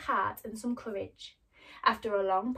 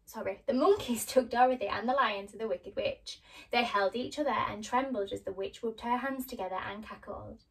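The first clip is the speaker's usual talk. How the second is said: plain reading voice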